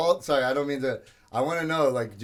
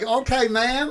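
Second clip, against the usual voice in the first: playing to the crowd; 10 semitones higher, 5 dB louder, slower than usual. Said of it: "You gotta play to the crowd".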